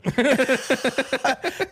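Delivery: high burst of real laughter. Real, not acted. Laughing at own story.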